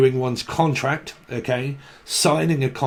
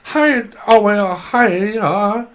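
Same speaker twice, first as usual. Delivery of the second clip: a bubbly high sing-song of hi's, louder than her own talk; chirpy spirit voice; 5 semitones higher, 4 dB louder, much slower than usual. Retold or imitated. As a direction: parrot an over-eager voice saying hello. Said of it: "I can hear someone's voice going".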